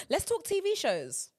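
gasp, then a slow, high announcement; slow, high-pitched gasp of excitement; excited gasp. Real, not acted. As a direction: gasp like you just had a great idea. Excited gasp starting new topic.

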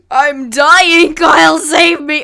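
loud high shriek of mock agony; hammed-up dying plea, shrieked; wildly exaggerated friend, wailing for help; Connor. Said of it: "he wasn't like that".

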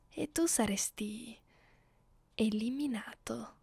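a soft whispered death threat; menacing whisper. Acted. Mistress threatening her prisoner.

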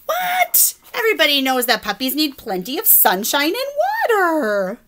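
high shocked what, then know-it-all; indignant pigeon. Acted. Pigeon correcting the reader, high and indignant. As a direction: pigeon insisting he knows best.

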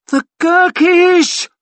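very high, loud, drawn-out wail; panicked wail. A narrator's reading. Quartermaster spots the enemy, terrified wail. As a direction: wail the alarm, stretching each word. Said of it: "he wailed".

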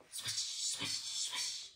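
soft, hissed swish effect; swishing noise. A narrator's reading. Storyteller voicing noises that wake Tortoise, soft swish. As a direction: stretch the sh like sliding snow.

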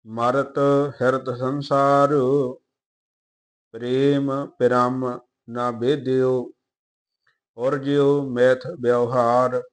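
slow, measured Gurbani reading; even, deliberate verse reading; scripture recitation. Acted. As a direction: recite line by line with drawn-out vowels.

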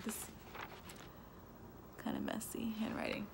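soft whispered side comment; whispered aside. Real, not acted. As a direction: drop to a whisper to excuse the slip. Reader's muttered aside about handwriting.

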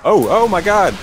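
sudden high startled cry; startled shout. Real, not acted. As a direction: blurt it out in shock. Bridge collapses under his character.

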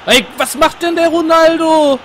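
slow, high, loud outcry; excited outcry. Real, not acted. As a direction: call out in disbelief. Reacting loudly to the game.

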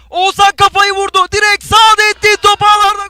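high, loud goal scream; screaming football commentator. Real, not acted. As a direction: yell the goal as it goes in. Goal call in live commentary.

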